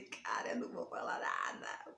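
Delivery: breathy whisper to herself; whispered inner thought. Retold or imitated. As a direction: hush it as if thinking aloud. Refusing, said inside her head.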